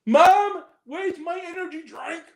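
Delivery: high bratty shout for mom; yelling across the room. Pretend. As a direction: call out to mom like a spoiled kid. Kid yelling to mom offstage.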